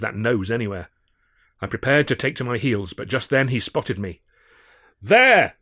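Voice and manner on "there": big loud bellow, pitched way up. Acted. Malden spotting the narrator, bellowing. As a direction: bellow it across the street. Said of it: "he bellowed".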